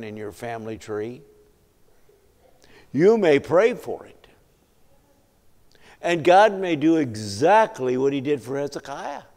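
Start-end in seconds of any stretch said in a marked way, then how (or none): 2.9-9.2 loud, emphatic sermon delivery; raised, forceful preacher's voice; full-force preaching. Real, not acted. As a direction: preach it out to the whole congregation.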